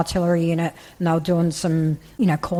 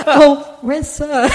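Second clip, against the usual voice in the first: half-laughing, pitched-up mock alarm; laughing retold gasp; 8 semitones higher, 6 dB louder, much slower than usual. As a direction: laugh through the line as you replay it. Retelling meeting his old sergeant major.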